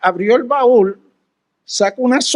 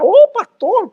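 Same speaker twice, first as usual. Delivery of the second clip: surprised greeting; 10 semitones higher, 3 dB louder than usual. Retold or imitated. Old sister greets the pastor.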